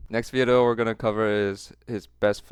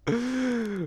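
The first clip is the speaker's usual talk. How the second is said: high laugh; 12 semitones higher, 4 dB quieter than usual. Real, not acted. Laughing at the clip.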